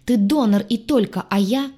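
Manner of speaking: indignant outburst cut short; enraged. A narrator's reading. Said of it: "явно взбешённый потерей поклона".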